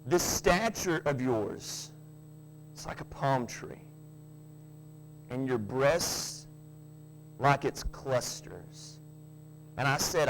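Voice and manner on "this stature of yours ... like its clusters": slow scripture reading. Acted. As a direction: let each phrase linger. Reads Song of Solomon slowly.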